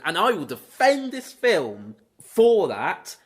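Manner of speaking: normal talking voice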